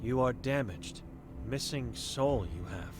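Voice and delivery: a hint of a stern old seer, guarded; wary old Vera sizing up a stranger; blind old woman.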